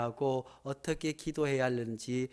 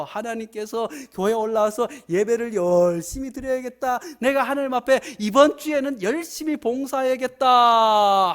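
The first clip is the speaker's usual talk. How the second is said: louder, higher preaching at full force; fiery preaching; 8 semitones higher, 10 dB louder than usual. Acted. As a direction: drive the point home to the congregation.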